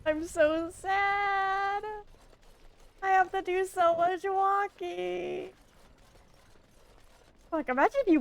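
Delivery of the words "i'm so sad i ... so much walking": high, slow whining wail; whiny wail. Acted. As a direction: wail it like a sulky child. Whining about walking to chat.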